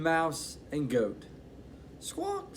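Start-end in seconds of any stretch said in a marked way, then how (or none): 2.0-2.6 very high squawking chicken; storyteller squawking for the chicken. Acted. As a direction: squawk like a hen. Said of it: "said the Chicken".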